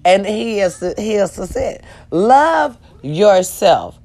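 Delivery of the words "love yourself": to viewers, slow and drawn out; slow, drawn-out love yourself. Shown as a demonstration. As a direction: stretch Love yourself very slowly. Slow then quicker repeat.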